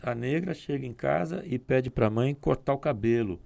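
normal talking voice

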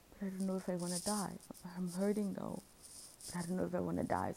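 quick, anxious inner argument; rushed, torn. Retold or imitated. Torn between hurting and dying, fast and pleading.

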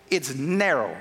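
a low, stretched "narrow"; drawn out narrow. Real, not acted. Preacher lowering his voice on "narrow" for the narrow way. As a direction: drop low and linger on "narrow".

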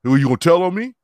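hammed-up street challenge; tough guy. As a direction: taunt like a petty thief. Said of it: "He said, he said".

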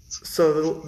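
ordinary talk, nothing marked

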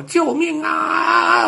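long, very high cry for help; terrified scream. A narrator's reading. Little dog fallen into mud pit, screaming in terror. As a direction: stretch out a high, panicked shout. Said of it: "吓得大叫".

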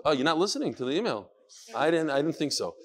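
normal talking voice

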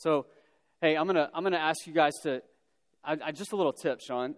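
ordinary talk, nothing marked

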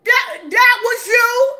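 high, loud, gleeful callout; excited shout. Real, not acted. Talking to the pastor she caught out, a high gleeful shout. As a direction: crow that you caught him. Catching him out, laughing.

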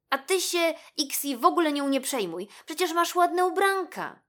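high, loud, lightly voiced friend; encouraging rabbit. A narrator's reading. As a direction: cheer up a hurt friend as the rabbit. Rabbit comforts the sad mouse. (pocieszający królik, wysoki głos)

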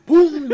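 loud high pum with a laugh; laughing crash sound effect; mock impact noise. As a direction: punch out the sound of the hit. Imitating the tackle hit.

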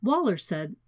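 ordinary delivery

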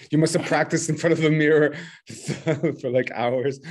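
teasing through laughter; laughing. Real, not acted. Joking to fellow teacher about mirror practice, laughing. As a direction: joke with a chuckle breaking in.

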